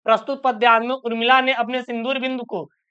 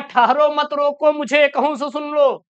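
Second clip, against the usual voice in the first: higher, slow chanting delivery; verse recitation; 4 semitones higher, slower than usual. Acted. Teacher quoting Kaikeyi's verse to the class, higher slow recitation. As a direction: call out the verse with stretched words.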